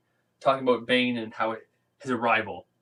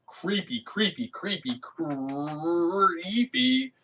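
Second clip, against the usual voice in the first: spooky; 6 semitones higher, 3 dB quieter, much slower than usual. Retold or imitated. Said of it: "I was like".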